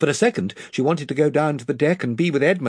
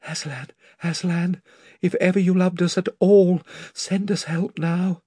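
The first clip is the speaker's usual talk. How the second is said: whispered prayer; 5 semitones higher than usual. A narrator's reading.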